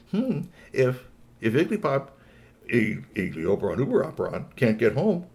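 pondering Willy Rob. Acted. Robot working out that they are stuck, pondering then emphatic.

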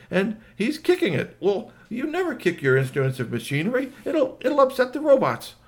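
high-pitched, perplexed alien voice; baffled alien. Acted. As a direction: fret like a visitor shocked by Earth ways. Alien watching man kick mower.

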